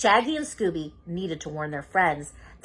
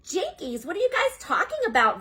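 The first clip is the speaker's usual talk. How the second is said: higher, fast, puzzled; Velma voice; 10 semitones higher than usual. Acted. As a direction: brush it off quickly.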